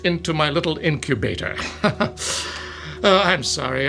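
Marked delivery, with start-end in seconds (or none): 1.6-3.0 sinister chuckle. Acted. Villain gloating over captive.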